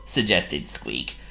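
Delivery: ordinary delivery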